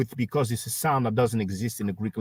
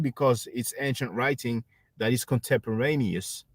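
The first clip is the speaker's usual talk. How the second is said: ordinary delivery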